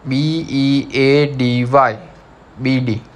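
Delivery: slowly spelled letters; spelling out letters. Shown as a demonstration. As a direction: spell it out for the class. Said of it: "Now number second is".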